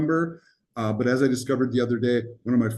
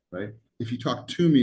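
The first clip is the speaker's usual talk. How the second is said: normal talking voice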